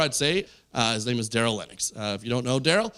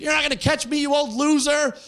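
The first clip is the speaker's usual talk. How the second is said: taunting; 13 semitones higher, 4 dB louder than usual. Retold or imitated. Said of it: "He's like".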